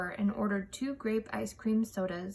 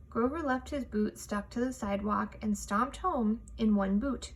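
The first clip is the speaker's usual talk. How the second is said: plain reading voice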